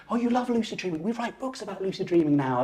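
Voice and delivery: put-on high, bright encouraging child-talk. Dreamer tells his five-year-old self about their life, encouraging. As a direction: coax a little kid, pitch lifted.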